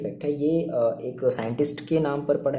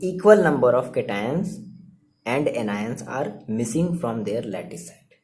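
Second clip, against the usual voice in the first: very slow, spaced-out definition; slow dictation; much slower than usual. Real, not acted. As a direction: dictate it word by word, drawing it out. (धीरे-धीरे परिभाषा लिखवाते हुए)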